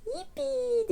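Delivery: high, drawn-out little squeal; storybook cheer. A narrator's reading. Peppa cheering about puddles, high mock shout. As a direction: cheer high without real volume. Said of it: "diye bağırıyor Peppa".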